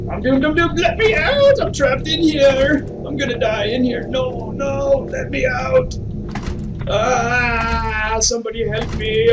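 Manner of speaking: half-joking scream for help; hammed-up panic. Real, not acted. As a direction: play up the terror of being locked in. Trapped by monsters in game.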